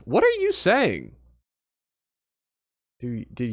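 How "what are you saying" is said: high, slow, incredulous; stunned by what chat wrote; shocked disbelief. Real, not acted.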